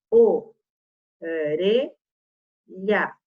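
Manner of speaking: "o, re, lha" em sílabas isoladas, com pausas; separando sílabas. Shown as a demonstration. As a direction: diga "o... re... lha", sílaba por sílaba. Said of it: "Se eu separar sílabas". ("o, re, lha" silabado devagar)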